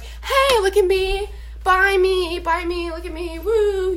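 mock attention-seeker. Mocking girls who flaunt themselves, high showy voice.

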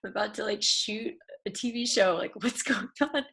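tearful laugh, pitch high; nervous laugh while still choked up; laughing through tears. Real, not acted. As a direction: laugh off the shock while upset.

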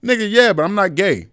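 high-pitched retold protest; indignant protest. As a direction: imitate yourself objecting, worked up.

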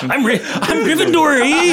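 put-on high laughing Mexican accent; joking Mexican accent. Continues the accent bit.

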